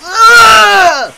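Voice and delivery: high loud burst of laughter. Real, not acted. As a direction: let out a big laugh. Laughing at the puking.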